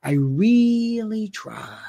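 slow whisper; slow it right down, hushed; slow whispered plea. Retold or imitated. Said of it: "he goes".

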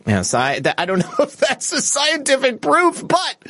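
breaking into high laughter mid-sentence; giggling while reading a viewer joke; laughing. Real, not acted. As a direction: laugh through the words, pitch jumping up. Host laughs at a viewer comment.

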